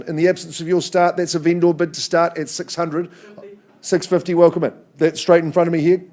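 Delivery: rally the room like an auctioneer; auctioneer calling. Acted. Auctioneer opens the bidding.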